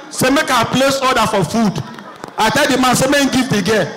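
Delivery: usual speaking voice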